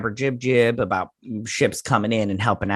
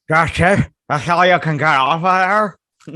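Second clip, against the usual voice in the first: higher, louder incredulous character voice; his way of talking; 4 semitones higher, 6 dB louder than usual. Retold or imitated. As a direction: mimic his disbelieving bark. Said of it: "this is how he talks".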